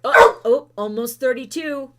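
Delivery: loud excited oh, oh. Real, not acted. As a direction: burst out with excitement. Excited at the timer.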